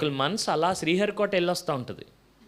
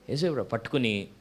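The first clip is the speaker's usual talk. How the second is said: usual speaking voice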